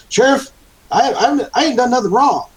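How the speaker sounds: high, loud Southern protest; high-voiced, indignant Southern prisoner; Southern accent. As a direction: plead innocence with a Southern twang. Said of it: "I'm converting to a Southern accent again".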